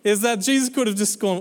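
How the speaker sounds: ordinary delivery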